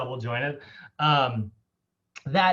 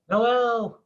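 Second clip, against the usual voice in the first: calling a name, higher and louder; calling out to a student lost from the call; 9 semitones higher, 6 dB louder, much slower than usual. Real, not acted. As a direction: call out to bring him back online. Calling to a student who dropped off.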